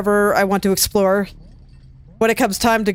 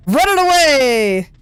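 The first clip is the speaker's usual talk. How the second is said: long drawn-out mock yell, higher; mock scream; 4 semitones higher, 4 dB louder, much slower than usual. Gamer fleeing the creatures in a game, slight mock scream. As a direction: stretch it out like a playful fleeing cry. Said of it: "slightly screaming".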